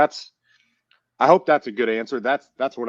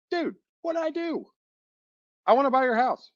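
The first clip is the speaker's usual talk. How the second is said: incredulous plea. Retold or imitated. Calling back the seller who hung up.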